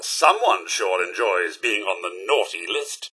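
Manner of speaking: ordinary talk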